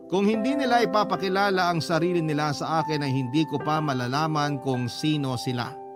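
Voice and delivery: normal talking voice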